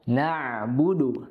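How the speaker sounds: "Na'budu" slow, throaty stop on the ain; clear ain. Shown as a demonstration. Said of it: "ain sukun dengan hamzah sukun berbeda".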